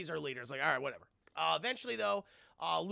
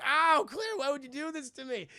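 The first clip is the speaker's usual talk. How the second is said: high mock cry of pain; hammed-up hurt complaint, loud at first; hurt brother; 6 semitones higher, 4 dB louder than usual. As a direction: wail it like an injured, betrayed brother. Acts Chris hurt by Claire.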